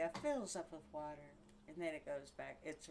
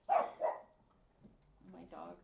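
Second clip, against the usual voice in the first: ordinary talk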